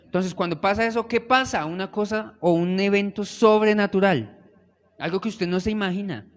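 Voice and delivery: loud, fervent preaching to the congregation; full-force preaching. Acted. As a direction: build the sermon to a roar.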